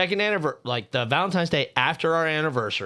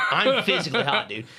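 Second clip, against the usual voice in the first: laughing exclaim; 5 semitones higher than usual. Real, not acted. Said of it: "I'm getting hot".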